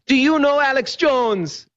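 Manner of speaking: high, slow Jamaican lilt; amateur imitation of a Jamaican woman's accent; terrible Jamaican accent. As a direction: put on a bad Jamaican accent, drawn out and high. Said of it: "I'm doing a terrible Jamaican accent".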